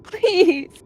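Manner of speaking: high, loud desperate cry; screamed plea. A narrator's reading. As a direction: scream out, begging for mercy. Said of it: "She screamed".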